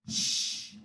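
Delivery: soft shush; quiet shh. Acted. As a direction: hush the others. Monkey hushing.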